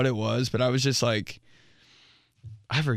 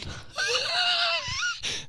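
high wheezing laugh; high squealing laugh; 5 dB quieter than usual. Real, not acted. Laughing at own story.

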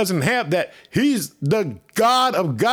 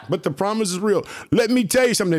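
ordinary talk

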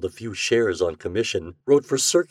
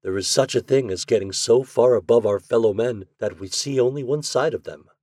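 ordinary delivery, nothing marked

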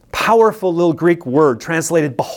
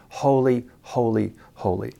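each "Holy" set apart by a pause, sinking softer; solemn repetition; 5 semitones lower, 5 dB quieter than usual. Real, not acted. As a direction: let every "holy" land alone, quieter each time. Solemnly dwelling on the chosen word.

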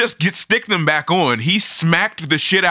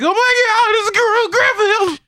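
hammed-up, very high hysterical wail; piercingly high parody of a hysterical woman. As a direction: shriek it in mock hysterics. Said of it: "like, hysterical".

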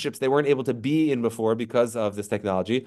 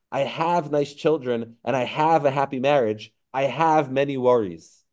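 each "have" leaned on, slow and deliberate; stress on have; slower than usual. Shown as a demonstration. Teacher reading a patient's words, stressing "have". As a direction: hit every "have" like a complaint list. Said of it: "we'll count the number of halves".

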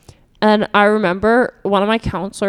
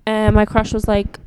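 usual speaking voice

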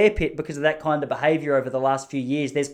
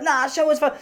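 much higher mock heckler yell; 12 semitones higher than usual. Hecklers demanding more, high mock jeer. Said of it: "They're like".

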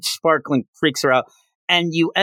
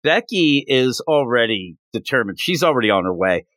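ordinary talk

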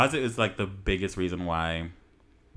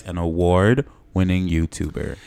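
"award-winning YouTuber" slow, loud and lower; boastful repeat; 4 semitones lower, 7 dB louder, slower than usual. Real, not acted. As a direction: announce "award-winning YouTuber" deliberately, every word weighted. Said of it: "I'm gonna repeat that for you guys".

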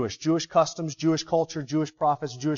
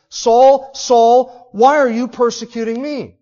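loud, higher calling of Saul's name; proclaimed call of Jesus; 8 semitones higher, 10 dB louder, slower than usual. Acted. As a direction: cry the name out over the crowd. Quoting Jesus again, proclaimed.

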